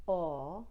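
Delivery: "Aw" with rounded lips; rounded aw sound; aw vowel. Shown as a demonstration. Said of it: "going from aw".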